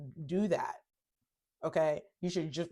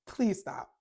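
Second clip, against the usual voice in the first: ordinary talk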